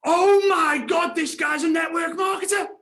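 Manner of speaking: high, drawn-out excited yell; top-of-voice shout. Retold or imitated. Dad blurting out mid-movie, at the top of his voice. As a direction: bellow it like an amazed dad. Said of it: "at the top of his voice".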